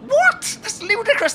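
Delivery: high-pitched disbelief; incredulous. Acted. Friend told his arm is being grabbed, incredulous. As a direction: yelp it in baffled disbelief.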